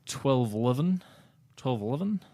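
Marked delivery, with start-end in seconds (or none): 1.6-2.2 New Zealand accent. Shown as a demonstration. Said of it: "You sound like a New Zealander".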